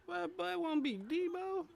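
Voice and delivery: very high, squeaky voice; squeaky high exclaim. Acted. Streamer reacts to the character in a squeaky high voice. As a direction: squeak it out in disbelief.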